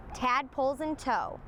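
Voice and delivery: usual speaking voice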